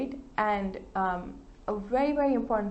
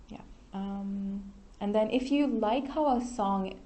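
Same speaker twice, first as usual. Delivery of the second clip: ordinary delivery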